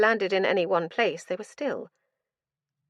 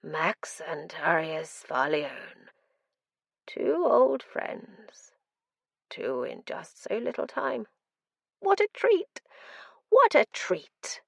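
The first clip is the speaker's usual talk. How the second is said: mad prisoner voice; slower than usual. Acted. Mad prisoner greets old friends.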